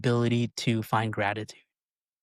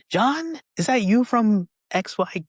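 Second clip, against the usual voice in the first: much higher, louder surprised question; surprised recognition; 11 semitones higher, 6 dB louder than usual. Retold or imitated. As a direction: sound amazed to find him there. Surprised passerby recognizes him.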